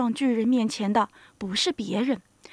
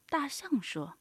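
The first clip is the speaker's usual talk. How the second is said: plain reading voice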